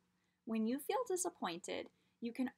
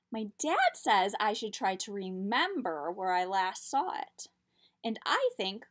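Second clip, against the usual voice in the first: lively storybook read-aloud; reading to children; 7 dB louder than usual. A narrator's reading. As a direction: tell it like a picture book. Reads picture book aloud.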